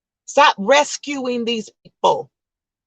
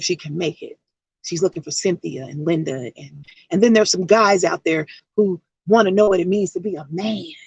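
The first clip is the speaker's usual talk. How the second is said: soft, low heartfelt appeal; low hushed preacher's plea; earnest preaching plea; 5 semitones lower, 3 dB quieter than usual. Acted. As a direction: plead with the audience gently. Sermon-like plea.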